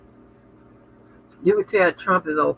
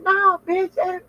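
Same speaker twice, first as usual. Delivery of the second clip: high mock protest. Telling her friend no, high mock protest. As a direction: squeal a high no. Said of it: "I'm like".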